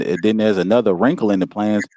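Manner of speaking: ordinary delivery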